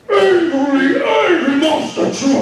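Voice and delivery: loud, high monster-truck roar of a voice; hammed-up angry shout; mock angry shout. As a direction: belt it like a cartoon monster truck.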